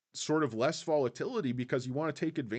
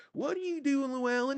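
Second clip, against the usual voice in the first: much higher, put-on woman's voice quoting the film; movie wife line; 14 semitones higher than usual. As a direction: squeak it out as the worried wife. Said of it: "it reminds me of No Country for Old Men".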